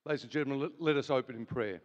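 normal talking voice

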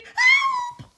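very high, very loud scream for help; piercingly high storybook shriek. A narrator's reading. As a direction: scream it in full earnest. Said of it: "Betty screamed".

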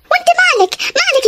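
outraged jealous wife; angry retort. Acted. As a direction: fire it back at her. Wife snaps during street quarrel.

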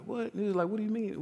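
high, puzzled mock "what"; hammed-up baffled response; surprised. Mentor surprised by kids.

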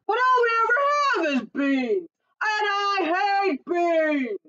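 high, drawn-out complaining shout; indignant cry. A narrator's reading. Giant complaining to his wife about beans, crying out. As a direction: wail the complaint out big. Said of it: "he cried".

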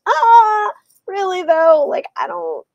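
high, loud "really though?"; incredulous, a bit sarcastic; exaggerated disbelief at the ending; mock disbelief. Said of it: "And I'm like".

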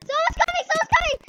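high-pitched, urgent alert; alarmed warning. Real, not acted. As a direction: warn your team that someone is coming. Warning teammates during a game.

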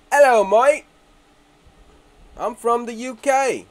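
a loose, hammed-up "Hello, mate" in a vague British accent; mock British accent. Greeting viewers in a hammed-up mock British accent. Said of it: "Or is that Australia?".

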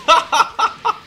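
gleeful laugh. Real, not acted. Laughs after kicking opponent.

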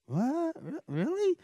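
high stammered disbelief; can't believe the praise, voice jumps up; disbelieving. Retold or imitated.

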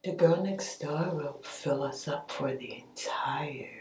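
hushed scheming whisper; vampire whisper. Acted. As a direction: breathe it out like a lurking vampire.

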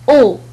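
teacher modelling "أو"; French sound demo. Shown as a demonstration. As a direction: model "أو" for students. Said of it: "ينطق معا ك".

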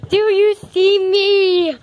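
slow, loud mock question; imitating him. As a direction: draw it out as a teasing imitation. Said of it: "He's like".

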